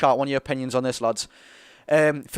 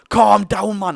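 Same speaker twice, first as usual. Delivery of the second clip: a louder, higher stranger's voice barking calm down; bloke telling him to calm down; 4 semitones higher, 8 dB louder, much slower than usual. Retold or imitated. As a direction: play the bloke behind him snapping at him to calm down. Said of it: "some bloke behind us goes".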